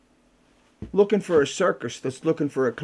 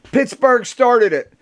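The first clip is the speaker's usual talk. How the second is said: slow, higher and louder jab; mock retort; 9 semitones higher, 8 dB louder, much slower than usual. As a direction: drag out the comeback. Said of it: "wrote down on a piece of paper".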